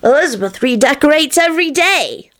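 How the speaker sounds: put-on high little-kid voice, mildly forlorn; high childish regret; sad child. A narrator's reading. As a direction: say it like a wistful small child. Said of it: "he said sadly".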